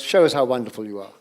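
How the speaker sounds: usual speaking voice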